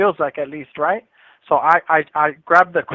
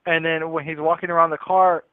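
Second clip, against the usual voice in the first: ordinary delivery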